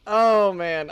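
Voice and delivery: normal talking voice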